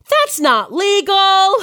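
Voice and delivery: put-on American accent. American friend objecting to sex work, mock American accent. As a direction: declare it illegal in a put-on American voice. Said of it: "my accent's terrible".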